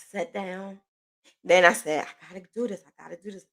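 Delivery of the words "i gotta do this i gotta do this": hushed, private pep talk; very soft, determined self-talk, as retold; quiet self-urging. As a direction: tell yourself under your breath.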